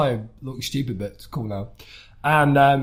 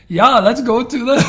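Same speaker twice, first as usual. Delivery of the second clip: a kid's hammed-up German accent, higher and louder; mock German accent; 10 semitones higher, 9 dB louder than usual. Child actor told to do German accent, clumsy hammed-up German accent. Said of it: "I was just going like".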